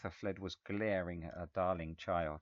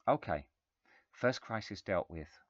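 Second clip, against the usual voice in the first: ordinary delivery, nothing marked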